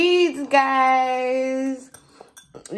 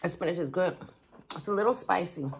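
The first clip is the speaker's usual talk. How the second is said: talking between bites; mouth full, chewing; 4 semitones lower, 7 dB quieter, faster than usual. Real, not acted. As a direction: food in mouth. Seen 0: Lips closed, cheeks working at 0.6–1.0; food held at mouth throughout.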